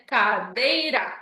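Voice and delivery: "Cadeira" split slowly into ca-dei-ra; syllable by syllable. Shown as a demonstration. Teacher showing syllable division, "Cadeira" said slowly in parts. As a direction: draw out each syllable of "Cadeira". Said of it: "fazer a divisão de sílabas".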